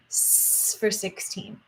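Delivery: long soft hiss on the s; drawn-out s like a snake. Shown as a demonstration. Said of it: "hissing sound like a snake".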